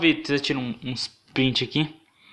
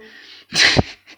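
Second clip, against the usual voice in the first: gargalhada; 9 dB louder than usual. Real, not acted. Risada forte.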